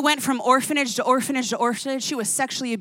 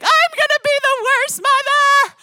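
much higher, melodramatic mock wail; dramatic self-pity; 13 semitones higher, 7 dB louder than usual. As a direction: wail it like the world is ending. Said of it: "so I'm like".

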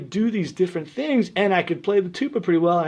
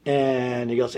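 normal talking voice

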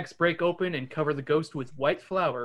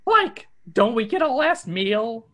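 Shaggy voice; 5 semitones higher, 5 dB louder than usual. Acted. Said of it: "Shaggy asks".

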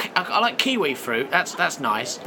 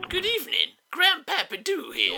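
ancient man on phone; 10 semitones higher than usual. Acted. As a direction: draw it out like a very old man. Even older relative answers.

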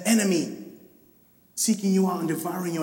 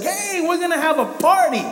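high, loud, delighted call; joyful father; 10 semitones higher, 6 dB louder than usual. Retold or imitated. Father welcoming his lost son home, high and loud with joy. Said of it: "his dad's just like".

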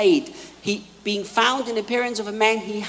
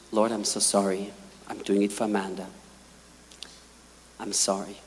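much lower, softer repentance; contrite apology; 12 semitones lower, 6 dB quieter than usual. Retold or imitated. Candy apologizes to the Lord, soft contrite voice. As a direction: confess it softly, humbled.

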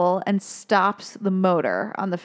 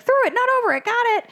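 much higher, chirpy Dory echo of "Through it, not over it."; Dory eagerly repeating the warning, much higher; 14 semitones higher, much faster than usual. Retold or imitated. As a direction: parrot the advice back way up high. Dory repeats fish advice.

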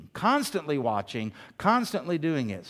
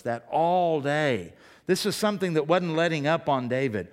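ordinary talk

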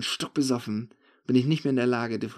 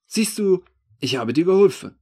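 high, loud, cocky remark; cheeky; 7 semitones higher, 9 dB louder than usual. A narrator's reading. Thief handing back the stolen phone, cheeky. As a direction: crow it with brazen cheek. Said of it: "meint er noch frech".

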